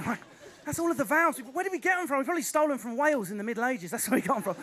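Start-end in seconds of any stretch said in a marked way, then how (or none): none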